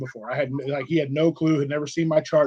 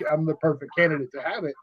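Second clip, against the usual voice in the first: ordinary delivery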